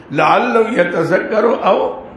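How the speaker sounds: very slow, drawn-out Arabic verse; Quran recitation. Real, not acted. Preacher returns to the verse for his listeners, slow recitation. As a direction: linger on the long vowels.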